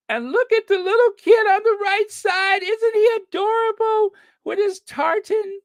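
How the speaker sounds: very high, squeaky coo; baby-talk gushing. Acted. Host cooing over a little kid in tartan, baby-talk gushing.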